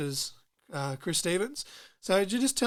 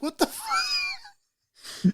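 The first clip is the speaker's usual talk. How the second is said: stunned; much slower than usual. Retold or imitated. Retold reaction to brother's song.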